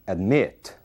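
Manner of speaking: weak first syllable, strong MIT in admit; stress on the second syllable. Shown as a demonstration. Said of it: "A sílaba tônica é o mit".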